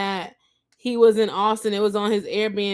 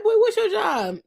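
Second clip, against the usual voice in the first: high, laughing jab; laughing mock question; 11 semitones higher than usual. Vlogger mocking the jobless contestant, laughing. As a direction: ask it through a laugh, teasing.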